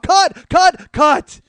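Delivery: high, loud repeated call; director's shout. Retold or imitated. Director halts the battle scene, urgent loud shouting. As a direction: holler it over a chaotic set. Imitates director stopping the take.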